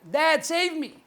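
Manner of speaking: high-pitched plea to dad; child calling father. Acted. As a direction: cry for rescue as the child. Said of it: "child calling out to the father".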